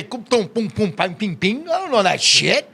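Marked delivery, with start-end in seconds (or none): 0.0-1.7 slow nonsense syllables imitating Chinese; made-up Chinese gibberish, drawn out; mock Chinese. Man admitting he can't speak the language, mock Chinese babble. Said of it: "I don't know how to say".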